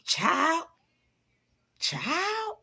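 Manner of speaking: high, drawn-out, rising disbelief; incredulous child. Acted. Reacting to people who don't know their worth, incredulous. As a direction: stretch it up high in mock disbelief. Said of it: "And I'm making the face because it's like".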